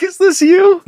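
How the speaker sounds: high, laughing judge voice; laughing question. Retold or imitated. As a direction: ask it through laughter.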